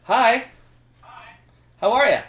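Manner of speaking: high, loud hello; eager greeting. Real, not acted. Greeting strangers on video chat, eager and high. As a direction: call out a cheery greeting.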